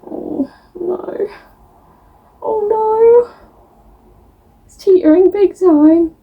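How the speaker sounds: high, thin worried voice; alarmed squeal. Real, not acted. Vlogger watching her book pile wobble, high-pitched alarm.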